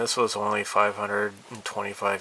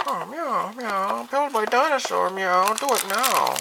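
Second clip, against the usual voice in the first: much higher, loud playful cat voice; squeaky kitty voice; 11 semitones higher, 4 dB louder than usual. Pretend. Vlogger voicing the toy kitty, very high. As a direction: squeak it like a bossy kitten.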